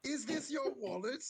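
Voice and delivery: very high, grand magician reveal; spring the reveal like a magician; high showman voice. Acted.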